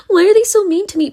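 high, loud, fast whining imitation; mock a hurt, whiny victim. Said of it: "be like".

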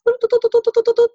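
rapid high, loud "doop" imitation; high beeping noise. Shown as a demonstration. Telling a client about thoughts sent into the universe, high playful beeping. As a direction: pop out a string of high doops.

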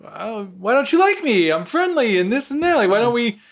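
high-pitched friendly appeal, mock hurt; acted pleading. Speaker voices their own wish to be liked, mock pleading.

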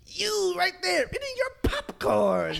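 high, cackling mock witch voice. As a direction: screech it like a cartoon witch. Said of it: "the witch came out and said".

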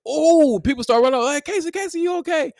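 high, loud retold cries. Retold cry and worried kids.

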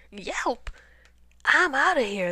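high, loud frightened exclamation; alarmed bear making a getaway; panicked yelp. A narrator's reading. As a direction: blurt it while fleeing. Terrified bear flees.

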